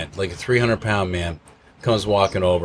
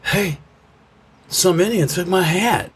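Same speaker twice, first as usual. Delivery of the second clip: put-on raised-pitch dopey drunk voice; drunk friend; 11 semitones higher than usual. Retelling the drunk friend's complaint, higher dopey voice. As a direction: play the confused drunk buddy. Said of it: "And he goes".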